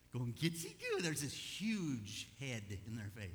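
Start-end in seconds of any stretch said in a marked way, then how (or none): 0.3-1.0 very high squeaky coo; cutesy baby talk. Acted. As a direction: tickle a baby with an exaggerated falsetto. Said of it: "leaning over the baby bed".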